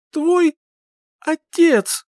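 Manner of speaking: high, halting struggle to speak; labored words. A narrator's reading. Heroine straining against her oath, labored. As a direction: push each word out separately. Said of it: "Слова давались с трудом".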